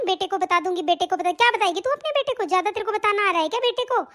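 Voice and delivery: fast, sneering bossy bahu; mock the old woman and shoo her out; mocking daughter-in-law. Daughter-in-law mocks and scolds fast.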